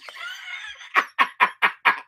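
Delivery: high-pitched staccato laugh; laughing outburst. Real, not acted. Drunk streamer cracks up over Vince Russo, high laughing burst.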